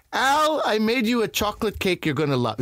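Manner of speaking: high, sing-out friendly mother voice; loving, gregarious mother. Retold or imitated. Buddy's mother offering him cake, loving high-pitched voice. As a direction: call out fondly, pitched well up. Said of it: "she was a loving, gregarious woman".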